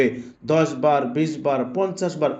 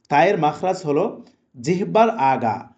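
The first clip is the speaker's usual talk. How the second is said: ordinary delivery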